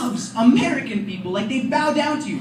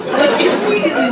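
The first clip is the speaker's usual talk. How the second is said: usual speaking voice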